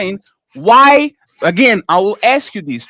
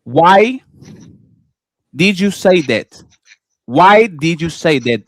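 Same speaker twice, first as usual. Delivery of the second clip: slow, low, measured questioning; deliberate repeated question; 6 semitones lower, slower than usual. Real, not acted. Debater repeating his question to an evasive opponent, slow and deliberate. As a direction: spell the question out slowly, pausing after "Why". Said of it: "I repeat again".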